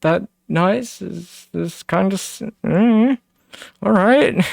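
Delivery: high squeaky mock disbelief; airy high voice, playing at disbelief; mock incredulous self. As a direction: pitch way up, mocking your own doubt. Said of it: "but I'm like".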